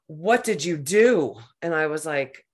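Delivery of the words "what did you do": livid repeat. Retold or imitated. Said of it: "just livid".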